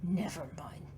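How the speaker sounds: breathy whisper, letting it drop. Acted. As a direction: murmur it to herself and give up. Backing off mid-argument.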